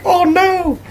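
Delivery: very high-pitched pretend regret; mock dismay. Blamed by his friend for her crying, mock dismay. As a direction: squeal it in put-on dismay.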